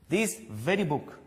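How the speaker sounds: slow, separated "This very book", "very" swelling in pitch; stress on very. Shown as a demonstration. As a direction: punch "very" slowly and deliberately. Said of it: "giving more stress on this word very".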